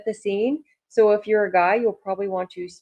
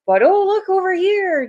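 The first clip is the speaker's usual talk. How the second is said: sarcastic mock reveal; 12 semitones higher, 6 dB louder than usual.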